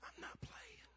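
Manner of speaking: hushed, voiceless whisper; whispered retort. Retold or imitated. Benched son answers his yelling dad from the sideline, whispering. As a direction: mouth it back under your breath.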